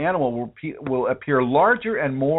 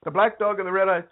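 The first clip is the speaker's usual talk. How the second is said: high, put-on Indian accent of a guru's follower; high-pitched mock Indian accent that slides toward Mexican; bad Indian accent; 10 semitones higher than usual. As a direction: play a devotee urging you to your guru in a shaky Indian accent. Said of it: "I'm not really good at my Indian accent today".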